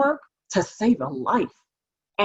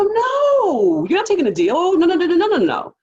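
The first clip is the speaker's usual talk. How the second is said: much higher and louder, insistent no; emphatic refusal; 10 semitones higher, 4 dB louder than usual. Real, not acted. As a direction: shut the idea down hard, over and over. Lawyer refusing client's plea deal.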